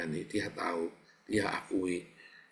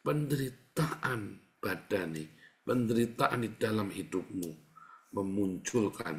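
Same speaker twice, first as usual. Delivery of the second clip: ordinary talk, nothing marked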